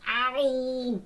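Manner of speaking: long, high cartoon ow; hammed-up sustained cry of pain; pained howl. Dog punched hard in the chest, pained howl. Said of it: "and then he falls".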